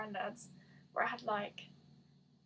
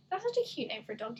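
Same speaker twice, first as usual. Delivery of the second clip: usual speaking voice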